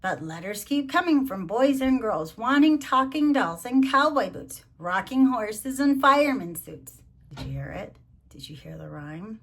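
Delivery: ordinary delivery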